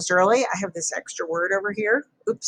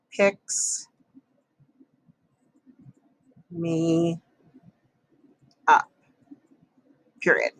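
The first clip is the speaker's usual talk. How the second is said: dictating; much slower than usual. Shown as a demonstration. Writing sentence while saying it.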